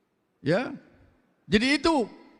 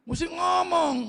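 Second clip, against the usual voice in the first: shouted urging; 8 semitones higher than usual. Real, not acted. Preacher urging listeners to speak.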